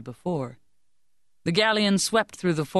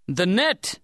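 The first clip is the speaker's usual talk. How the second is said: higher, restrained shouted command; captain's shout; 4 semitones higher than usual. A narrator's reading. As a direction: call out an order without full volume. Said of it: "Captain Deschirroux's shout overwhelmed the song".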